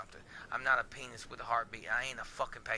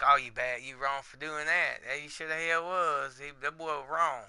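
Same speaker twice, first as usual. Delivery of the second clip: louder, mocking voice of the scolding boss; 9 dB louder than usual. Mocking the job that blamed him for taking time off, louder. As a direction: throw the boss's blame back at him, hammed up. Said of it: "be like".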